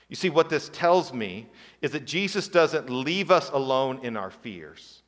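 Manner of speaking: ordinary talk, nothing marked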